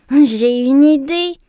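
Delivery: loud, slow, eager cry; excited shout. A narrator's reading. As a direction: burst out with the idea. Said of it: "s'écrie-t-il".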